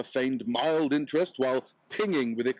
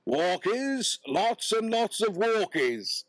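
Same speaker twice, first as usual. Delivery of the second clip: higher, drawn-out call to the dog; 8 semitones higher, slower than usual. Acted. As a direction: sing out walkies to old Buckles. Calling the dog.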